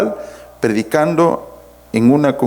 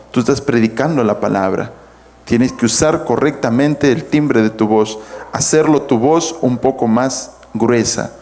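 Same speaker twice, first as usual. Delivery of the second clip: ordinary talk, nothing marked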